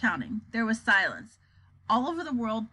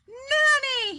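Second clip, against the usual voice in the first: high, wailing call for nanny; cry; 13 semitones higher, 4 dB louder than usual. A narrator's reading. President crying for his nanny, high wail. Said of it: "he cried".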